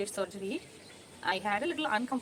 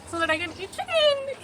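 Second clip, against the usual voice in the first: excited; 12 semitones higher, 5 dB louder than usual. Real, not acted. Joking about eating chicken.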